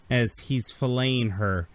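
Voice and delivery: usual speaking voice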